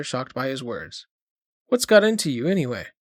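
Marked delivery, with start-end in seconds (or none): 1.7-2.9 a weak try at sounding commanding, pitched up; lame demand. A narrator's reading. Mother half-heartedly demanding an answer from son. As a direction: demand it, but the authority falters. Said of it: "she demanded, with a lame attempt at imperativeness".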